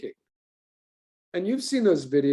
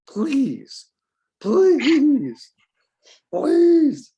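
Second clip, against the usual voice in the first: high, louder whiny pleading; hammed-up whiny please rising each time; mock begging; 11 semitones higher, 7 dB louder, much slower than usual. Teacher begging for thumbnails.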